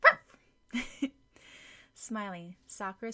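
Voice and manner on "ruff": high, loud yappy puppy barks; puppy barking. Acted. Injured puppy barking up at Sakura, high yappy barks. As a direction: bark like a small dog. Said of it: "and barked".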